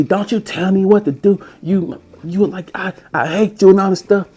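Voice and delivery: put-on high, hysterical girlfriend voice; high shrieking girlfriend. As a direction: shriek it like she's losing it. Ex voiced yelling at him.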